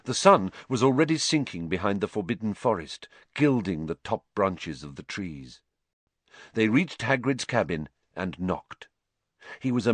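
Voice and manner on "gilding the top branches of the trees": ordinary delivery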